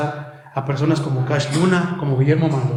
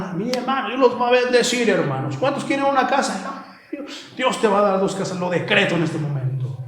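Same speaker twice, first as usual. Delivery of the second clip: higher, sing-along parody of a blessing preacher; mock prosperity preacher; 4 semitones higher than usual. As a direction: promise blessings in a put-on sweet sell. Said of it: "Porque les predicaban".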